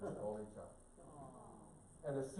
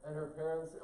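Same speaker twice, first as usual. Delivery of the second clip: usual speaking voice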